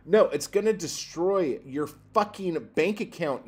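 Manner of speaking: usual speaking voice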